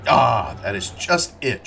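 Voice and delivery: a mild, half-full shout. A narrator's reading. Herron, seizing on Behrman's point, mildly shouts back. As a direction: raise the voice on each word. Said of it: "shouted Herron".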